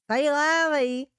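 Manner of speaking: high, loud Southern "say la vie"; French phrase in a broad Southern accent. Acted. Shrugging off a job that pays bills, in a Southern accent. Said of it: "in a southern accent".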